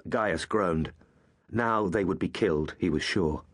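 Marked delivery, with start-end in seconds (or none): none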